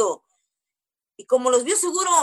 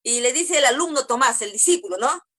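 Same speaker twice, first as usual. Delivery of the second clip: usual speaking voice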